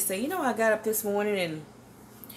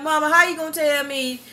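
mock protest; 4 semitones higher, 5 dB louder than usual. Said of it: "I'm like".

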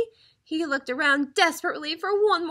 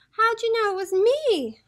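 howling turkey; 8 semitones higher, 4 dB louder than usual. A narrator's reading. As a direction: howl it as a found-out turkey. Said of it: "howled the turkey".

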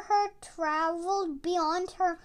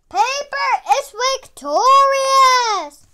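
fight announcer; 7 semitones higher, 11 dB louder than usual. Acted. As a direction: boom it out like a ring announcer. Announcer declares the winner.

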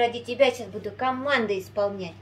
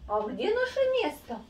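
high singsong question to a dog; squeaky dog coaxing; 10 semitones higher than usual. Real, not acted. Owner coaxing dog to its spot.

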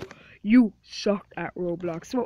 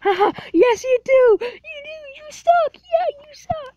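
very high, loud, mocking sing-song; bratty kid voice jeering you suck; taunting high voice; 8 dB louder than usual.

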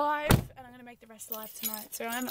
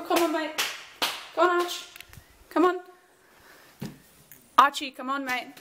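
calling out; 4 semitones higher than usual. Real, not acted. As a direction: coax the dogs to come outside. Calling dogs, not listening.

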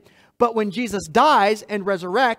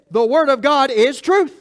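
louder ringing declaration, pitch raised; climactic proclamation; 5 semitones higher, 7 dB louder than usual. Acted. Preacher reaching his conclusion to the church, loud proclamation. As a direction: proclaim it as the big payoff.

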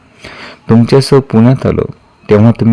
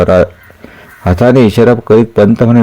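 ordinary delivery

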